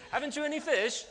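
very high, loud put-on English accent; hammed-up English accent, calling out in a high voice. Shown as a demonstration. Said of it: "it's in an English accent".

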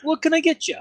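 very high Canadian barkeep; high put-on Canadian accent, taking an order; mock Canadian accent. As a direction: serve customers as a hammy Canadian innkeeper. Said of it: "And he had an accent".

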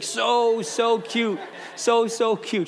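put-on high-pitched cooing over a baby; gushing. Pastor describing a freshly fed newborn, gushing. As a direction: melt over how adorable it is.

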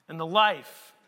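usual speaking voice

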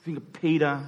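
ordinary talk, nothing marked

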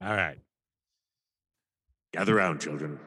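gather them in like a wise elder; storyteller voice. Pretend. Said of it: "It's story time".

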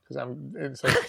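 usual speaking voice

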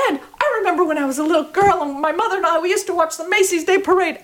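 high, fast, laughing gush; gushing excitement. Retold or imitated. Dancer gushing a fake memory to the panel, fast and high. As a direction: spill the made-up memory eagerly.

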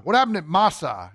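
ordinary talk, nothing marked

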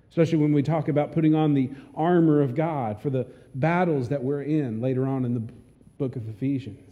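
normal talking voice

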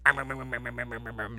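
playful chomping sounds; mock eating noise; munching noises. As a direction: imitate bees chewing. Imitates bees chewing.

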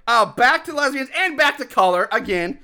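ordinary talk

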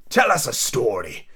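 slow, drawn-out demand; drawling storybook creature; March Hare voice. Acted. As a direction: drag each word out lazily.

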